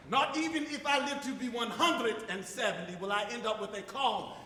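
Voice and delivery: ordinary talk, nothing marked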